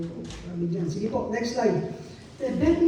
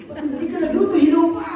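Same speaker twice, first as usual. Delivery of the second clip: mock angry spouse; 8 semitones higher, 7 dB louder, faster than usual.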